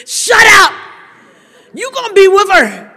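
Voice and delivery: loud, sharp shut-down; angry snap. Retold or imitated. Grieving woman snapping at condescending visitors, loud. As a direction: bite the words off hard.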